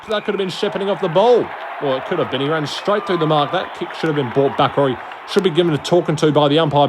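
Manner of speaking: usual speaking voice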